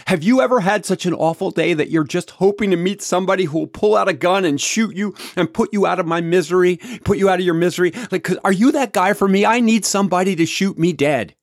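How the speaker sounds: high, loud, frantic plea to be put out of misery; desperate plea. Retold or imitated. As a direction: beg like a man at the end of his rope. Mark pleading with angry driver.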